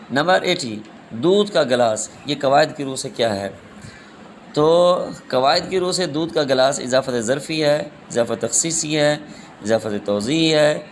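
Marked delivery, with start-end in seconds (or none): none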